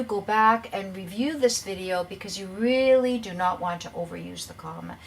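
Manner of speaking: ordinary talk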